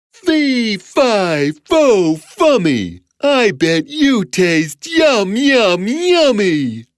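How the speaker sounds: high, stretched chanting giant voice; hungry giant. A narrator's reading. Giant holding Jack to eat him, gloating. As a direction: relish each word greedily. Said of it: "said the giant".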